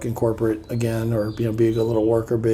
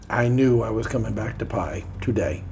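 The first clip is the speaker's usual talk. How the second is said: ordinary delivery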